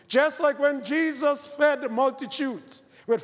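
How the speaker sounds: high, loud preaching, building fervor; full-force preaching. Acted. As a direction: preach it out to the whole congregation.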